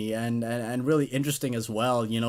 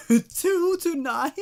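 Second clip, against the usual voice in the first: amused disbelief; 14 semitones higher, much slower than usual. Real, not acted. Amused aside on ad text.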